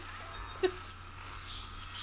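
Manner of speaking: breathy high-pitched laugh. Real, not acted. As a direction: let out a high giggle. Laughs at finding no clue.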